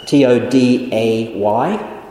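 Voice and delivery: letter by letter; spelled out. Shown as a demonstration. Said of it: "spelling the word".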